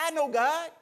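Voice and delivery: high, loud indignant retort; retold loud argument. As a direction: fire it back as in a quarrel. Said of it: "a loud discussion".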